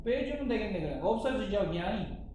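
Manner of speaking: usual speaking voice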